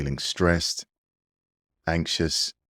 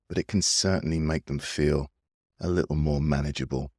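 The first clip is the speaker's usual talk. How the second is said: guided meditation. Acted. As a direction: ease the listener into calm breathing.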